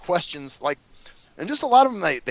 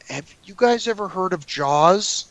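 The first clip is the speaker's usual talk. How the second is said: slow, odd put-on question; slow clueless question to the panel; awkward attendee; 2 semitones higher, slower than usual. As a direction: ask it like a clueless audience member. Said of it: "this guy raises his hand and he goes".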